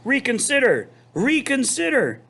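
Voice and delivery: high, loud goose plea; high desperate honk; goose crying out. Acted. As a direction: beg him to turn back. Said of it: "cried the goose".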